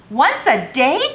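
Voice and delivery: appalled disbelief; voice rising. Acted. Madam reacts to Ruth's frequent fits, shocked rising voice. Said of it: "Madam's voice rose again".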